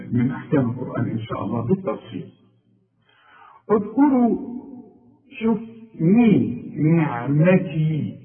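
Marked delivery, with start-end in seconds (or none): 3.7-4.4 اذكروا said high with a rounded u at the start; pronunciation demo. Shown as a demonstration. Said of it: "أقول اذكروا". 6.0-8.2 مين نعمتي said slowly with a clear i on the ن; kasra on nun. Shown as a demonstration. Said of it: "شوف النون دي بكسرها إزاي".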